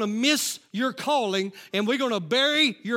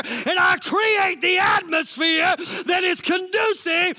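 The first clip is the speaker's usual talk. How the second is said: shouted, climbing sermon delivery; full-force preaching; 4 semitones higher than usual. Real, not acted. Preacher urging congregation to change the atmosphere, full-force preaching. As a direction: build to a rousing pulpit cry.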